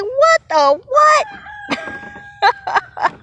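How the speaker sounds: high, disbelieving double take; mock flabbergasted cry; baffled what. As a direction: act out a stunned what-the. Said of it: "I'm like".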